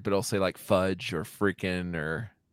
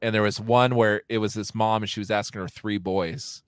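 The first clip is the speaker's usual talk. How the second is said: ordinary talk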